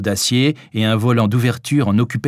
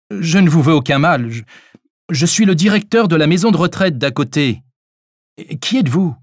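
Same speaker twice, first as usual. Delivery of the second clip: loud, higher-pitched pleading explanation; urgent calling out; 7 semitones higher, 5 dB louder than usual. A narrator's reading. Doctor explaining himself to armed stranger, calling urgently. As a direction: call out to reassure an armed stranger.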